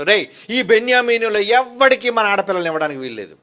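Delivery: angry oath. Acted. Voices the Israelites' oath.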